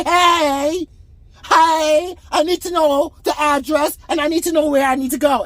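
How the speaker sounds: a hint of a sassy, flamboyant stranger; flamboyant man. Imitating a flamboyant stranger asking him for directions. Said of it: "gives me two snaps and a twist and say".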